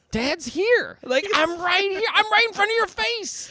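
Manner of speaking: high, stretched mock-indignant protest; exasperated, strained high-pitched outburst; indignant parent. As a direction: protest in a high voice that you're being ignored. Parent's indignant reply to kids ignoring him.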